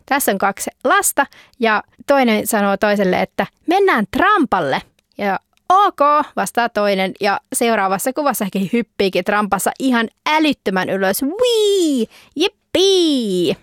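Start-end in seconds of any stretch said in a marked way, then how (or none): 3.7-4.8 put-on eager kid. Child suggests trampoline. 5.7-6.0 high, bright chirp of agreement; eager okay. Acted. Second child agreeing to the trampoline, high eager okay. 11.2-13.6 high whooping joy while bouncing; gleeful squeal. Acted. Kids jumping sky-high on the trampoline, gleeful high whoops.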